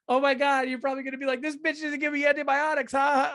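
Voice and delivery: high, loud, giggly-giddy college-girl imitation. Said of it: "She was like a giddy college girl".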